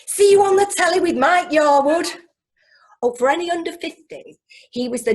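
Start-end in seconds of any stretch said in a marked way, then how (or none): 0.1-2.1 loud, high mocking send-off; teasing jibe. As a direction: tease the school leaver. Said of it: "someone said".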